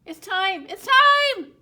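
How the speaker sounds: high, very loud frantic squawk. A narrator's reading. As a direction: squawk it out in a panic. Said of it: "Duck squawked".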